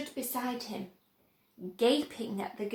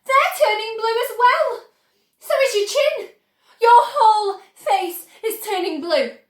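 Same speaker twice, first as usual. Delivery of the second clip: high, loud panicked scream, near tears; 8 semitones higher, 10 dB louder than usual. A narrator's reading. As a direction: shriek in rising horror. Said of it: "screamed Missus Beauregard".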